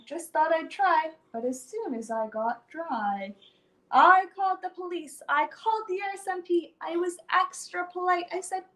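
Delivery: ordinary delivery, nothing marked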